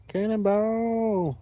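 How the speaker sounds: high, slow sing-song call; drawn-out cannonball call. Pretend. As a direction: stretch it out like a playful shout. Shouting cannonball at the water.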